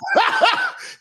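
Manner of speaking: high-pitched imitation cackle; put-on runaway laugh; mimicked cackle. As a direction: cackle out of control, mimicking her. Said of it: "when you add that laugh".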